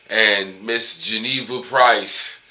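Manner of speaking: ordinary talk, nothing marked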